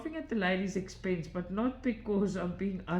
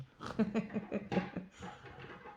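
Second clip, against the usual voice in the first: soft breathy chuckle; quiet airy laugh; 5 semitones higher, 4 dB quieter than usual. Real, not acted. Laughing about the bird.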